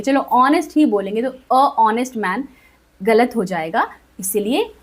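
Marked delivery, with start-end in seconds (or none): none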